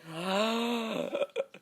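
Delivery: long, high audible gasp; big gulping inhale; gasp for breath. Real, not acted. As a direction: suck in air after running out of breath. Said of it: "trying very hard not to struggle to breathe".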